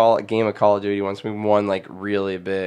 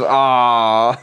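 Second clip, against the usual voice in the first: mock aw; 6 dB louder than usual. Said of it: "And everyone goes".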